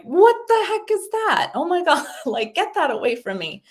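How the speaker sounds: high, startled squeal at the dentist's tools; mock alarm. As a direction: recoil from the strange instrument, half laughing. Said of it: "and you're like".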